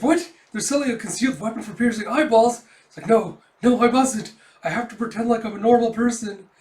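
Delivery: high, loud flustered outburst; alarmed. Acted. Yor tempted by imagined concealed weapons, alarmed. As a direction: react in shock, then rein yourself in.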